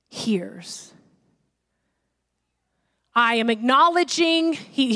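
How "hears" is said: a soft scriptural whisper; hushed whisper. Acted. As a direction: whisper the last word of the verse. Reading Eli's line, voice drops away.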